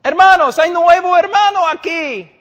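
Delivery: mock a pastor gushing before the church; hammed-up pastor. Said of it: "Y el pastor dice".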